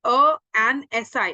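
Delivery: self-correct to an, emphatic and slow; corrected demo. Shown as a demonstration. Teacher corrects a to an for students. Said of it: "क्योंकि short form है".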